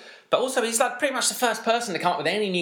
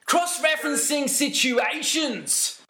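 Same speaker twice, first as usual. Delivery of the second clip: higher and slow abstract commentator phrasing; imitating Giggsy; 12 semitones higher, slower than usual. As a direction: draw out the odd phrase like the commentator. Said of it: "say something really quite abstract".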